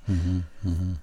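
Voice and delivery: muffled mm-hmm mid-sip; sipping coffee. Real, not acted. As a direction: hum through a sip of coffee. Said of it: "Sorry, I'm sipping coffee".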